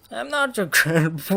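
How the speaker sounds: breaking into a laugh mid-sentence. Real, not acted. Player cracking up at the Grandpa character, laughing. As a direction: laugh through the words, voice lifting. Joking while reading Grandpa's dialogue.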